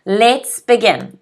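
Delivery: usual speaking voice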